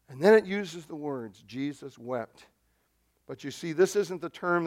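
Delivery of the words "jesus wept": solemn hush. Real, not acted. Solemn quoting of the verse.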